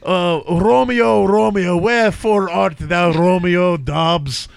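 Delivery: put-on comic Russian accent. Host joking about bear-fighting players, Russian accent. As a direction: play a vodka-loving Russian, dropping the articles. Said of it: "Three is Russian".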